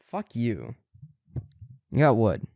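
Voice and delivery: ordinary delivery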